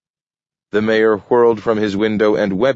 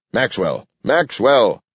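repeated urgent name call, second one higher; mild shout; 5 semitones higher than usual. A narrator's reading. Flustered mayor calls Maxwell on the radio, mildly shouting. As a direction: call twice, building. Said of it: "he shouted".

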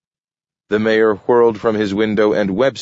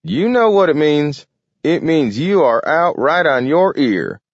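put-on high, loud old-timer voice; crowing old Gramp; 10 semitones higher, 5 dB louder than usual. Gramp tells the mayor he is out, crowing. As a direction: jeer gleefully in a high old voice.

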